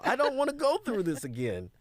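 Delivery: hammed-up despair at the mentor; exaggerated plea from his younger self; despairing past self. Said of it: "I was like".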